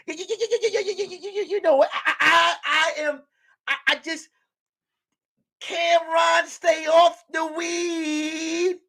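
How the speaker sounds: high, stammering sports-pundit rant; Stephen A. Smith voice. Retold or imitated. Mock pundit scolding Cameron on air, high stuttering rant. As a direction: stutter then hammer the name like a TV hot take. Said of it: "Stephen A. Smith voice".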